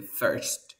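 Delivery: "first" with an E-type vowel; teacher showing the E sound in "first". Shown as a demonstration. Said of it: "este sonido tipo E".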